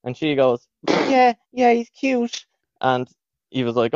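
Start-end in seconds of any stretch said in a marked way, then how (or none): 0.9-2.4 put-on high-pitched little Wexford girl; mock Wexford accent. Girl saying she likes the boy, mock Wexford accent. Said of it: "they had that horrible accent".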